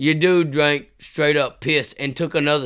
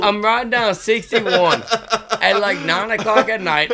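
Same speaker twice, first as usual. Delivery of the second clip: words breaking up with real laughter, louder and higher; laughing hard; 6 semitones higher, 5 dB louder, slower than usual. Real, not acted. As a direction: laugh through the telling, slowly. Laughing while telling the story.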